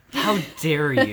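laughing through words; giggling fake outrage; laughing mock offense.

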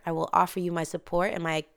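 normal talking voice

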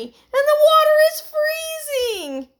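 very high, loud wail; shriek. A narrator's reading. Heidi in freezing water, shrieking. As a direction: shriek it, stretching the words. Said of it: "shrieked Heidi".

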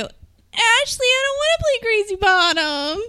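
put-on high squeaky child voice; little girl voice. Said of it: "wake up in the middle of the night and go".

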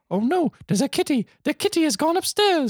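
hammed-up, very high-pitched lady voice; older sorority lady. Sorority lady fretting about her kitty, mock high voice. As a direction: squeal it like a silly, fussy caricature. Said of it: "She doesn't sound like that at all".

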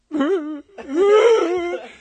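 high, loud hammed-up wailing sobs; exaggerated fake bawling; mock sobbing. Dumped boyfriend once she really left, mock sobbing. Acts out crying after breakup.

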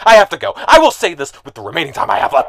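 usual speaking voice

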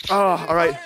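ordinary talk, nothing marked

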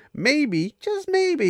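maybe swooping way up; teasing maybe. Retold or imitated. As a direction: tease her with a high, sing-song maybe. Teasing rebuke, drawn-out maybe.

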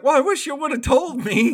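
high, loud snooty doctor voice; mock doctor. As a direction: play the doctor as smug and put out. Retelling the doctor's callous line.

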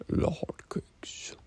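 whispered under the breath. Real, not acted. Vlogger to viewers, whispering the hotcakes.

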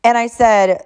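usual speaking voice

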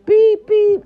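high, loud beep sound over a cuss word; spoken censor beeps; self-bleep. Acted. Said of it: "I'm gonna bleep myself out".

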